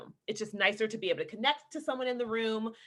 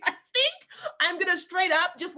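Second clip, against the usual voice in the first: high, loud mocking neighbor voice; mock oblivious neighbor; 9 semitones higher, 5 dB louder than usual. Neighbor deciding to mow during taping, loudly mocked. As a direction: exaggerate the neighbor's bright idea. Mimicking an ill-timed neighbor.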